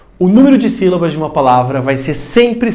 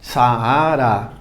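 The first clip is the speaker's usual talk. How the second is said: "Saara" lower, split as Sa-a-ra; syllable by syllable; 5 semitones lower than usual. Shown as a demonstration. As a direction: sound out three syllables in "Saara". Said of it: "Três sílabas".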